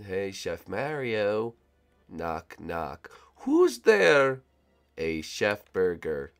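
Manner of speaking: low taunting voice. Acted. Minion knock-knock taunt.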